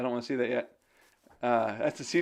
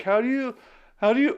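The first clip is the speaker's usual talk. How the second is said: high, loud put-on voice; hammed-up impression of an earnest asker; exaggerated friend imitation; 9 semitones higher, 6 dB louder than usual. Imitating Josiah asking a question, exaggerated voice. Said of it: "he doesn't talk like this".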